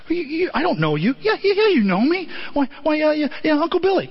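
high, pleading, stumbling insistence; desperate George. Retold or imitated. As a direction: George insists his mother knows him, frantic. George pleading with his mother.

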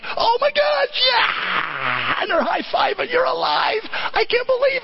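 high, shouted joyful disbelief; overjoyed cheering. Retold or imitated. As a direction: cheer like fans at a buzzer-beater. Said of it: "The disciples are like".

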